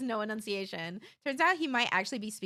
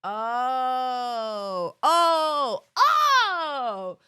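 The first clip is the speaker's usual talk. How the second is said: dawning realisation; 8 dB louder, much slower than usual. Real, not acted. Streamer learning canny means can't, growing realisation. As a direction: let the penny drop, louder each time.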